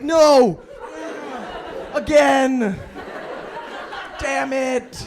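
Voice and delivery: loud, high exasperated shouts; frustrated yell. Acted. [name] yelling back at his own mind, frustrated shout. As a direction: yell it in comic self-disgust. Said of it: "And I'm like".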